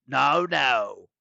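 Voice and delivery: loud villain's cut-in; warning. A narrator's reading. Villain silencing Nico's outburst, loud warning. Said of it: "Doctor Thorne warned".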